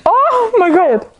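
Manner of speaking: high, drawn-out exclamation; playful dismay. Real, not acted. Speaker asked to repeat it all slowly, playful dismay. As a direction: groan it out high and slow.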